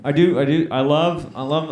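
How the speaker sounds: ordinary talk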